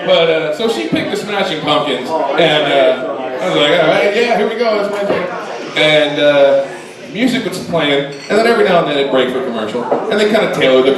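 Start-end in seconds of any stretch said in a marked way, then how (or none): none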